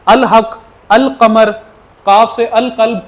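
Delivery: loud clear demo of अल कमर with the lam sounded; lam pronounced. Shown as a demonstration. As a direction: say अल हक, अल कमर, अल कल्ब with a full clear l. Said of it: "यानी इस तरह से पढ़ना है".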